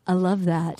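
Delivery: low, soft, understated man's voice; imitating the bachelor. Recapping his reply to a declaration, low mock sincerity. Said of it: "And he goes".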